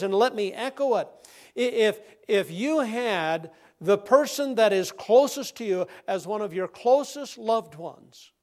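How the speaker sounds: ordinary talk, nothing marked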